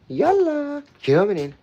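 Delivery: very high, loud sing-song call; high call to puppies. Acted. Owner calling the puppies in, high sing-song. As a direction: sing the dog in.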